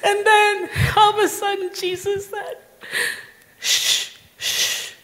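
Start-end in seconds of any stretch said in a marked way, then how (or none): 3.6-4.9 unvoiced drawn-out shh; hushing. Jesus quiets her to listen, hushing. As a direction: hush someone gently.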